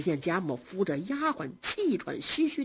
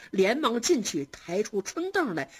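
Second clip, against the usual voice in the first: plain reading voice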